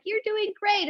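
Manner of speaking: very high, sing-song encouragement; high mock praise. Retelling the praise to her yoga class, pitched high. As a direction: chirp the praise like an overeager cheerleader.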